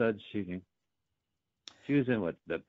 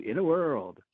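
higher, bouncy "In a World"; cheerful movie-trailer read; upbeat trailer voice; 6 semitones higher, 4 dB louder, slower than usual. Pretend. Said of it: "but upbeat. Gotcha".

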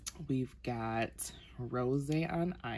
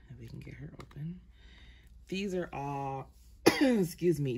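ordinary talk, nothing marked

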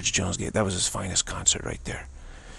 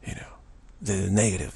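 ordinary talk

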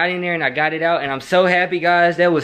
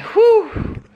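relieved whew. Real, not acted. Relief recounting scare.

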